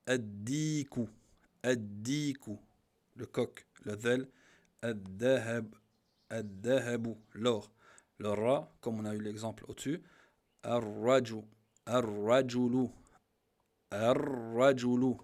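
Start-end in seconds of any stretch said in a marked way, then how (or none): 0.1-1.1 ad-diku drawn out, the d doubled; slow demo word. Shown as a demonstration. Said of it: "Le del". 10.6-15.2 ar-rajulu slow and loud, the r doubled and rolled; Arabic demo word. Shown as a demonstration. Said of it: "Bien insister sur la shadda".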